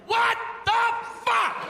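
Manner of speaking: extremely high, slow, shrieking disbelief; a viewer shrieks at the video; horrified shriek. Retold or imitated. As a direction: shriek in outraged horror. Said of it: "And you're like".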